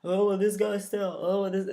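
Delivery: lightly voiced jaded internet fans; mock the cynical online crowd; smart-mark fans mocked. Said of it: "It's not so".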